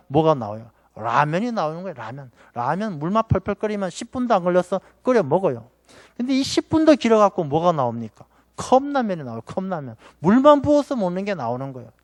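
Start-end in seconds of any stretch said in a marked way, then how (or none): 1.9-2.3 라면 repeated low and much softer, like an afterthought; soft echo. Shown as a demonstration. Preacher echoes the word to the church, softly. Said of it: "repeats the word quietly". 9.4-10.0 컵라면 repeated softer as a quiet echo. Shown as a demonstration. Preacher echoes the word to the church, softly. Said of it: "repeats the word quietly".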